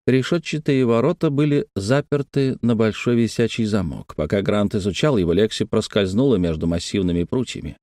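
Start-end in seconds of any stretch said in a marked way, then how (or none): none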